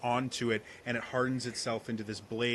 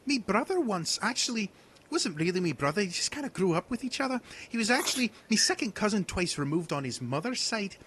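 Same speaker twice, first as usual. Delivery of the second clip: higher, rambling rustic storyteller; rambling hunter; 8 semitones higher than usual. Acted. Hunter telling the party about his brother, rambling.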